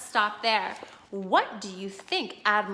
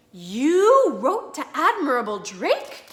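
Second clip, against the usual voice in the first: slow, louder astonished question; amazed wife; 6 semitones higher, 8 dB louder, much slower than usual. Acted. Wife astonished her husband wrote the admiral, amazed. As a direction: draw it out in disbelief.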